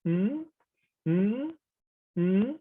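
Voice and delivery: questioning "mm" that climbs high; rising tone. Shown as a demonstration. Teacher showing learners the second tone, rising tone. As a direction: lift "mm" steadily to the top. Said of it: "is the rising tone".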